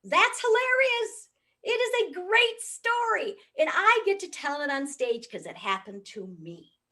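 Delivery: thrilled reframing, as if the insult were a gift; a bright, bubbly burst of glee; delighted thought. Pretend. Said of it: "a path that said".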